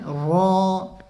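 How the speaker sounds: रॉ pressed down and said loud and full; pressed heavy ra. Shown as a demonstration. Teacher to viewers, the correct forceful रॉ. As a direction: press down hard on रॉ. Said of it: "दबाकर पढ़ेंगे, ज़ोर देकर".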